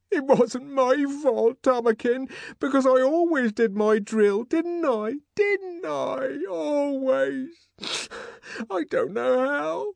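high, slow, tearful pleading; mild sob through the words; sobbing. Acted. Linda sobbing to the director, high and tearful.

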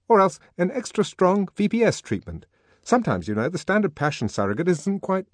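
high, loud eager prescribing; Henry pressing on. A narrator's reading. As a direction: push the cure brightly. Henry pressing his suggestions.

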